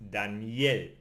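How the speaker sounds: "Daniel" stressed on the final -el, Spanish style; stress on last syllable. Shown as a demonstration. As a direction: put the weight on the end of "Daniel". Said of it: "energy moves to the very end".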